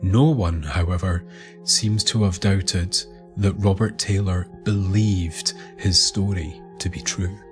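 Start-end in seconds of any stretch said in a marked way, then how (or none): none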